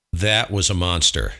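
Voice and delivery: ordinary delivery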